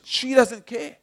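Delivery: indignant sulky complaint to the Lord; defiant. Retold or imitated. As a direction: throw it back defiantly, sulking. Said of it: "And I said quite defiantly".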